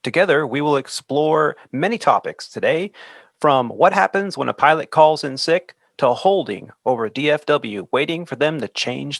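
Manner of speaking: smooth show-intro delivery; podcast announcer read. Shown as a demonstration. Host reading the intro to listeners as an announcer. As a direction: introduce the episode topics to listeners.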